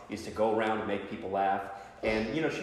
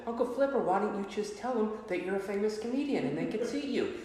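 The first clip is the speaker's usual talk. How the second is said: niece voice; 10 semitones higher, 3 dB quieter than usual. Retold or imitated. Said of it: "my niece said".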